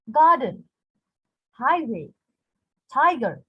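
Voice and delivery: "garden, highway, tiger" each strong then weak; stress on first syllable. Shown as a demonstration. Teacher listing trochaic examples, first syllable stressed. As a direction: punch GAR, HIGH, TI in "garden, highway, tiger". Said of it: "So this is trochaic foot".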